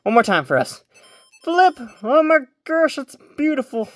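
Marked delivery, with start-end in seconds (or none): 1.4-1.8 very high, loud little cheer; sudden high, loud squeal; excited squeal. Real, not acted. Cheering the pet's flip. 2.0-3.9 much higher, breathless wonder; high, awestruck gush; awed delight. Real, not acted. Awe at the flip.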